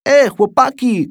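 głośne, wysokie nawoływanie kolegów; zawołaj przeciągle przez całe podwórko; okrzyk. A narrator's reading. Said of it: "krzyknął Alcest". (po polsku: przeciągły okrzyk chłopca)

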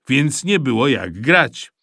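plain reading voice

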